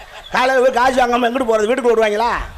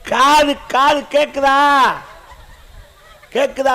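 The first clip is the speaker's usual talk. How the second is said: very slow, drawn-out question; slow hearing check; slower than usual. Acted. Man asks child if ears work, slow and drawn-out. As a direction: stretch every word for someone hard of hearing.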